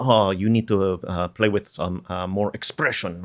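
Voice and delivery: a self-mocking, rough Tagalog accent for dad; father critiquing his son's playing in a mock Tagalog accent; terrible Filipino accent. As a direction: comment like a Filipino dad in a half-joking accent. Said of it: "that's the terrible Filipino, uh, Tagalog accent".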